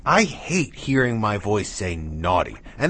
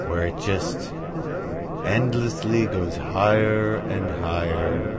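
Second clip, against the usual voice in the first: dreamy spacey drift. Acted. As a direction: drift off like the mind floating into space. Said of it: "I'm really losing it today".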